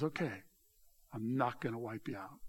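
low, soft concession; relenting. Acted. God relents toward the Ninevites, quietly giving in. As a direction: back down quietly, voice dropped.

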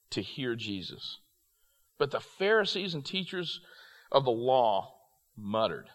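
measured reading from the Bible; scripture reading. Acted. Preacher reads Luke fifteen to young people, measured scripture reading. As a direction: read the passage to the room deliberately.

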